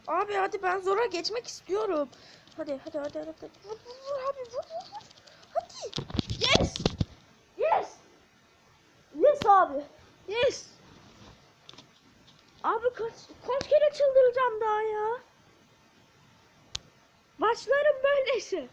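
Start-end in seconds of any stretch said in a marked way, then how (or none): 3.6-6.1 high, rapid calls to hit; urgent urging. Real, not acted. Gamer urging his teammates to shoot, high and urgent. 6.3-10.7 loud, high yes shouts; triumphant cheering. Real, not acted. Gamer cheering after a win, loud and high. 12.6-18.7 loud, high rant; exasperated yelling. Real, not acted. Gamer ranting loudly about his matches.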